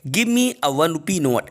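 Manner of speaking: very slow, each word set apart; slow dictation. Shown as a demonstration. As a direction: read the answer out slowly for the class. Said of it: "right answer होगा ए". (बहुत धीमे, हर शब्द अलग)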